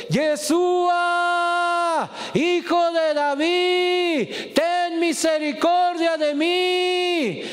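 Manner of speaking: high, slow, drawn-out cry for mercy; desperate shout. Retold or imitated. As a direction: blind beggar wailing over the crowd, high and drawn out. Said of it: "empezó a gritar más fuerte".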